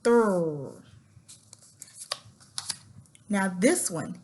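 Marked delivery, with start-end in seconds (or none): 0.0-0.8 "thrr" as th sliding into r; "thrr" sounded out as a phonics blend; blended THR sound. Shown as a demonstration. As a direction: run th into a held r on "thrr". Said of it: "this will say".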